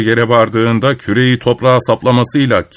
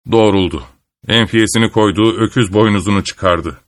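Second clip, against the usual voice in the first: usual speaking voice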